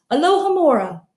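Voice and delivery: usual speaking voice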